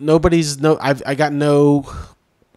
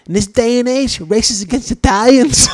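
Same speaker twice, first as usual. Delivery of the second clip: Joe Pesci style rant; 10 semitones higher than usual. Retold or imitated. Mocking the troll as a shouting Pesci-type mobster. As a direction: ham up an offended wise guy. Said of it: "stuck in some Joe Pesci movie".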